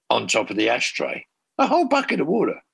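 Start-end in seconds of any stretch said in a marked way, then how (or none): 1.6-2.6 incredulous. Real, not acted. Incredulous retelling of fire marshal.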